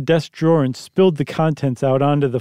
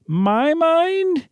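worried wife voice; 14 semitones higher than usual. Acted. Wife's startled high voice.

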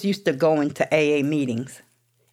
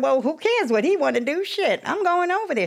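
defiant retort; 14 semitones higher than usual. Retold or imitated. Her own retold defiant reply.

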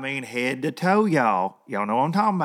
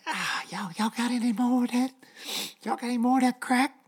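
high, twitchy junkie begging for more; twitchy slurred sniffing addict; 13 semitones higher than usual. Retold or imitated. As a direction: beg like a jittery meth addict. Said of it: "scratching like a meth addict".

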